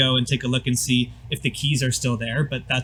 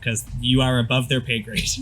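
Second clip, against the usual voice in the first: ordinary talk, nothing marked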